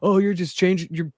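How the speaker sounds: high-pitched whiny imitation of critics; mocking complaint. Critics reacting to someone changing their mind, mocking complaint.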